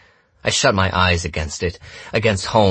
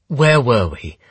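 raised, hard-edged question; tempered steel in the voice; steely; 6 semitones higher, 5 dB louder than usual. A narrator's reading. Holmes turns back to the gunman, steely. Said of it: "her voice like tempered steel".

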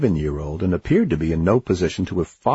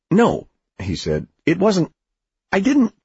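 protesting; 12 semitones higher, 3 dB louder than usual. A narrator's reading. Oliver protesting, alarmed.

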